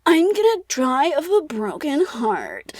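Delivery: slow, high, hammed-up lament; so dramatic. Eric announcing his heartbreak to the Persian, so dramatic. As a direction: draw out every word like a mock tragedy. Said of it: "like, so dramatic".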